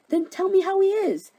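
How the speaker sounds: high, loud, frantic demand; angry demand. Acted. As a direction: demand it in hammed-up outrage. Hermia demands answer about Lysander.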